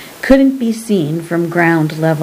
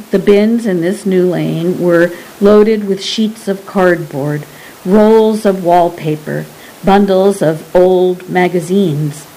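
ordinary delivery

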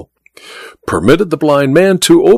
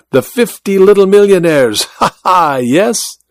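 higher, playful foreign showman with chuckles; Malvino's voice, teasing; 5 semitones higher than usual. A narrator's reading. The magician slyly teasing Godal about the millionaires' club, chuckling. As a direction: needle him about his club with a sly laugh.

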